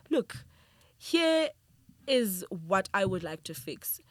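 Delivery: each word set apart, slow and firm; emphatic proposal. Retold or imitated. To a colleague at the table, slow and emphatic. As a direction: lay it out firmly across the table.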